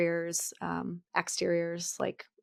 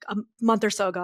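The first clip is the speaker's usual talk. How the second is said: ordinary talk, nothing marked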